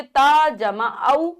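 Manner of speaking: very slow, each part held apart; slow spelling out. Shown as a demonstration. Teacher slowly spelling a syllable for children. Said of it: "इस प्रकार से होती है".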